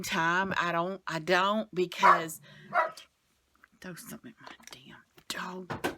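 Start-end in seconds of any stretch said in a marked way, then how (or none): 3.8-5.9 much softer, whispered off to the side; whispered aside. Real, not acted. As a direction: hiss it quietly, half to herself. Whispered aside about barking dog.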